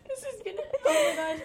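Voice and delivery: high giggling fit, laughing hard. Real, not acted. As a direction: crack up, can barely talk. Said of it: "We're laughing".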